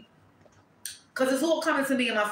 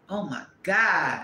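ordinary talk, nothing marked

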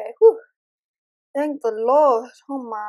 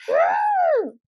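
squeaky high whimper; high frustrated whine; 6 dB louder than usual. Real, not acted. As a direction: whine in annoyance. Frustrated after game over.

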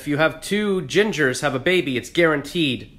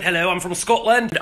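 put-on Scottish accent, pitched up; 5 semitones higher than usual. Shown as a demonstration. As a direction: show off your Scottish accent. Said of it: "I can do a Scottish accent".

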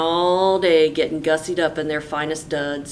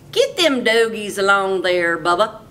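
put-on a bossy, louder older brother giving orders; 3 semitones higher, 6 dB louder than usual. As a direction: order him around like a spoiled cowhand. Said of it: "ordered Dwayne".

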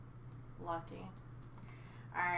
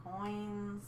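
ordinary talk

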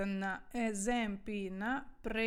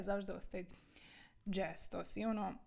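ordinary talk, nothing marked

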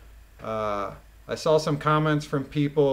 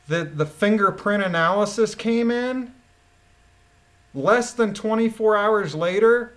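indignant rant; 3 semitones higher, 6 dB louder than usual. Real, not acted. Ranting about impossible turnaround time.

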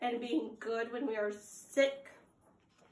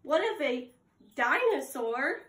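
ordinary talk, nothing marked

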